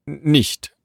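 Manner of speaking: a touch of bafflement; confused. A narrator's reading. Messenger told the master is absent, puzzled. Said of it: "fragte der Bote verwirrt".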